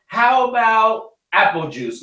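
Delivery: put-on high, slow toddler asking; little kid voice. Little son imitated.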